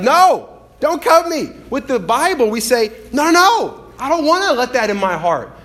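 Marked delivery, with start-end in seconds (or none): 0.0-1.5 high, loud yelp of refusal; mock panicked protest. Preacher acting out facing a blade, mock panicked protest. 3.1-5.5 high, loud, insistent protest; mock panicked refusal. Preacher voicing people resisting the Bible, mock panicked refusal.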